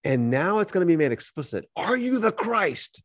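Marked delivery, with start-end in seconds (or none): 1.8-3.0 high, drawn-out demanding question; mock interrogation, raised and stretched; acted interrogator. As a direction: put the question slowly, pitched way up. Acts out questioning of Jesus.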